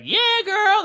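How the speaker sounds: very high squealed cheer; cheering girls. Retold or imitated. Class of girls cheering Kelly on. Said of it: "they were like".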